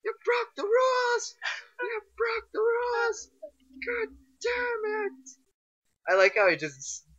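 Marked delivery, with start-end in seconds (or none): 0.0-5.3 high screaming rage at the game; raging gamer. Real, not acted. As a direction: shriek it out in full fury. Gamer raging at rule-breaking cheat.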